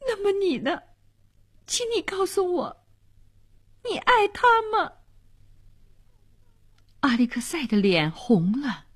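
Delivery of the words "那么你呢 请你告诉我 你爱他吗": high, softened pleading question; anxious pleading. A narrator's reading. Girl asks the man whom he loves, anxiously pleading. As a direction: plead for the truth, high and soft.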